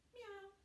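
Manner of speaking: small, high, soft meow; cat meow. Shown as a demonstration. Yoga teacher leading cat pose, cat meow. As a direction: squeak out a soft, falling kitten meow.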